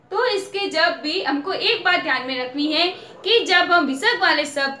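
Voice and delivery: ordinary talk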